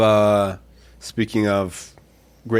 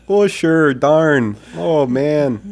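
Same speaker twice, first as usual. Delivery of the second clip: higher-pitched folksy Minnesota accent; mild Minnesota exclamations, pitched up; 5 semitones higher, much slower than usual. Shown as a demonstration. Host redoes Minnesota accent on request, pitched up. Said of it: "can you do your Minnesota one again?".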